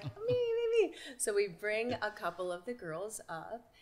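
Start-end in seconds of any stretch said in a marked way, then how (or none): 0.0-0.9 mock eager, much higher; imitating fans begging to be picked; eager cries. Fans begging to be picked, eager and high. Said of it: "they're all like".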